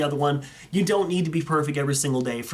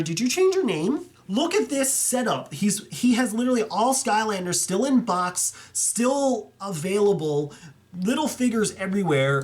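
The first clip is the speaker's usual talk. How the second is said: amazed; 5 semitones higher than usual. Real, not acted. Amazed by a huge collection.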